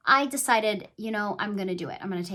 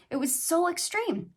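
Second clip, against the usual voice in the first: usual speaking voice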